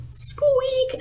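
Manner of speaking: very high drawn squeak; voiced imitation of a high squeak; squeaking door. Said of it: "And the door went".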